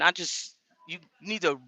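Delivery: ordinary talk, nothing marked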